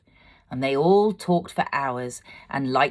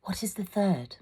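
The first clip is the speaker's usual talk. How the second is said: whispered question; hushed question; 5 semitones higher, 5 dB quieter than usual. A narrator's reading. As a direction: ask it under your breath. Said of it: "was silent for a moment".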